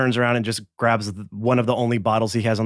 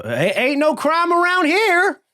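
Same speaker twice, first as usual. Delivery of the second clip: much higher, louder, slowed protest; loud nervous bartender; 3 dB louder, slower than usual. Acted. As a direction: blurt it out too loud so everyone hears. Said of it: "he says loudly".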